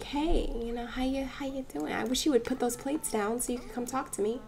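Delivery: quiet, casual flirting voice; soft chat-up. Acted. [name] chatting up a Sim, soft acted come-on. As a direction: murmur a tentative hello, acted.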